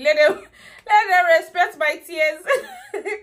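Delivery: high, loud, slow laugh-cry; laughing through tears. Real, not acted. Mother laughing at her own dramatic prayer, laughing. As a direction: crack up while you say it.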